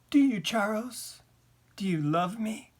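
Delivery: high, rising, needy question; pleading question. A narrator's reading. Wife asking husband if he loves her, pleading. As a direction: ask it imploringly, lifting at the end.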